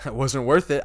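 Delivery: Tommy's cold loud comeback; loud retort. Retold or imitated. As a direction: punch out Tommy's retort loudly. Tommy's retort, retold by fan.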